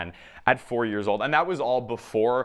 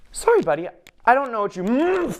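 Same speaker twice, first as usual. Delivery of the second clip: higher, louder plea broken off mid-sentence; hurried apology, cut off; 13 semitones higher, 6 dB louder than usual. Acted. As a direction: apologize as if about to be tackled. Cut off by tackling children.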